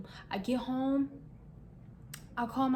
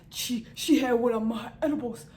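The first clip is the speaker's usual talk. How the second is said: geeked dealer impression; 2 semitones higher, 4 dB louder than usual. Drunk dealer Hakeem geeked.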